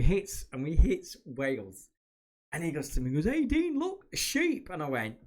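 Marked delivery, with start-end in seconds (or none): 3.2-4.7 high-pitched, loud imitation of an amazed mate; excited squeal, put on for the story; imitated amazement. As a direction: mimic a friend thrilled by a novel sight. Imitates friend amazed at seeing sheep.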